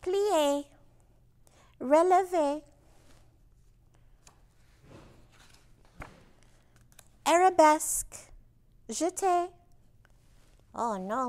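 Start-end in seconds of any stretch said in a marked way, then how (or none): 0.0-9.5 higher, louder ballet calls; commanding dance teacher. A narrator's reading. Ballet teacher calling steps to students, commanding. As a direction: call out each step to the class. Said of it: "turned her commands to her students".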